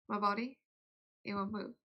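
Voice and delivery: normal talking voice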